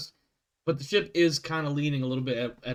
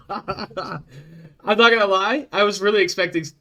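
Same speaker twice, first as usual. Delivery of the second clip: laughing; 5 semitones higher, 8 dB louder, slower than usual. Real, not acted. Laughing at the radio bit.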